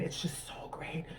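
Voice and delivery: slow, hushed mock rapture; hammed-up whispered swoon; mock ecstatic whisper. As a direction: breathe it out in a whisper. Hammed-up ecstasy over latte.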